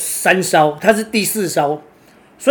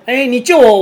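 higher, louder plea from the drowning rich man; mock cry for help; 7 semitones higher, 6 dB louder than usual. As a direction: beg the fisherman to save you. Rich man in fable begs rescue. (富翁落水大声求救)

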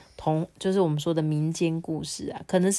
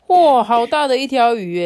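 大声高扬的一声惊叹; 看到大鱼忍不住叫出来; 6 semitones higher, 8 dB louder than usual. A narrator's reading. 官员看到大鱼惊叹. (官员看到大鱼大声惊叹)

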